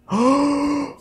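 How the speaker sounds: big, high, sharp gasp; real astonished intake of breath; shocked gasp. Real, not acted. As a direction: suck in air in amazement. Shock at the Jedi reveal.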